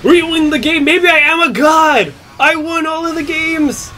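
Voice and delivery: high, loud victory yells; triumphant shouting. Real, not acted. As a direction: yell it like you just won. Wins the match, shouting.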